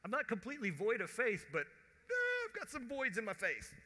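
high, loud, torn confession; reluctant admission. Acted. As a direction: admit it loudly with a squirm. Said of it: "You ever feel like that?".

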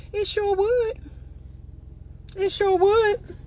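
high, drawn-out it sure would; a high, mock-swooning yes; delighted agreement. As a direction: savour the gift idea. Acting delighted bride-to-be.

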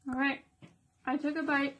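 ordinary delivery

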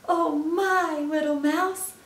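high, loud storybook fright; alarmed owl. Acted. Owl tells mouse of fright at Gruffalo, alarmed. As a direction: wail it in acted alarm.

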